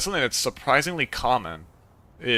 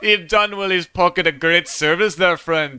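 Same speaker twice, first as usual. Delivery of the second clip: Scottish groundskeeper voice, higher; Scottish accent; 5 semitones higher than usual. Acted. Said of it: "You're going to do the Scottish accent".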